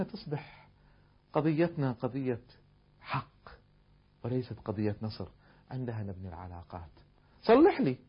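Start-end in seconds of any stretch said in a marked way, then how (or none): none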